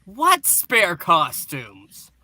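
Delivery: ordinary delivery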